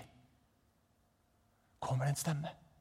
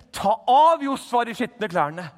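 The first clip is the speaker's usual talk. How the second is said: much higher, louder, slow commanding voice; authoritative God; 12 semitones higher, 11 dB louder, slower than usual. Acted. God silencing Satan, loud slow authoritative command. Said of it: "sier han bare myndig".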